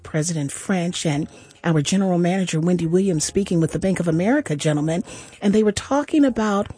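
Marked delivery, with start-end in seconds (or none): none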